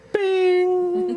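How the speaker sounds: a sudden high ding sound, sung out; mock bell ding. Host chimes in with a high bell ding. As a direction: ring it like a game-show bell. Sound effect after joke.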